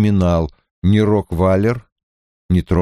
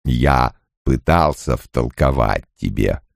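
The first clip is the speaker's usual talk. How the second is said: very low, slow character voice; Loial, ponderous and patient; 9 semitones lower, slower than usual. A narrator's reading. Loial explaining intrigue to his friend, slow and deep. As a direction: speak deep and unhurried, gently resigned.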